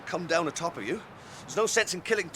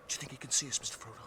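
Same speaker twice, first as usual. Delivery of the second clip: much softer, near whisper; 4 semitones lower, 10 dB quieter, faster than usual. Acted. Hobbits hide from Gollum on the cliff, whispering. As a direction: breathe it so Gollum can't hear.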